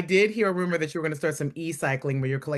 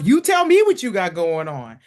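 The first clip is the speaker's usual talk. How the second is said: drawn-out excited demand; hyped up, slow; 6 semitones higher, 6 dB louder, slower than usual. Pretend. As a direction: stretch it out with mock hype. Mocking friend's hype.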